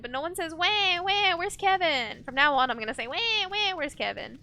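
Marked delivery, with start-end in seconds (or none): 0.5-2.2 high nasal-free whine, wah wah; obnoxious whiny voice. Retold or imitated. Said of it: "In that really obnoxious, whiny voice". 3.1-4.4 obnoxious whiny voice. Retold or imitated. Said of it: "In that really obnoxious, whiny voice".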